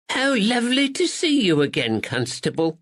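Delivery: high, bright-voiced kindly lady; sweet older lady greeting a visitor; Miss Bennett. Spinster greets the constable at the shop, pleasantly high.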